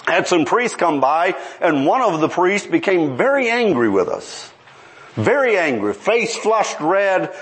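ordinary talk, nothing marked

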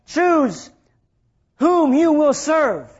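usual speaking voice

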